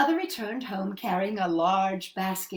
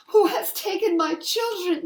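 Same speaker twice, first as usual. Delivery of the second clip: high, loud anguished cry; 8 semitones higher, 7 dB louder than usual. Acted. Mother finding her children gone, crying out. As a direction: wail it out in despair. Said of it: "she cried".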